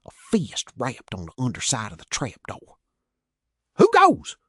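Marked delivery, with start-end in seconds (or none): 3.8-4.4 loud, much higher challenge; sharp guard's yell. A narrator's reading. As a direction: shout it through the trapdoor. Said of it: "Chris yelled".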